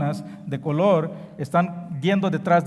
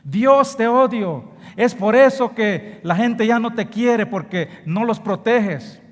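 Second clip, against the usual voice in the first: loud, higher furious cry at God; retold angry shouting; 5 semitones higher, 5 dB louder, slower than usual. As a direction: shout it out in rage at the Father. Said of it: "empieza a gritar". (gritando con rabia contra Dios)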